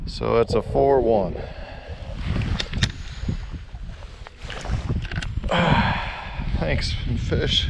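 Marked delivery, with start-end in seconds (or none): 1.4-6.5 long sigh. Real, not acted. Cold angler exhales after weighing fish.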